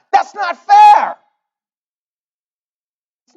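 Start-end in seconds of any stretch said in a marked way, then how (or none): 0.1-1.2 high, loud, slow protest shout. Real, not acted. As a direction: cry out against the unfairness.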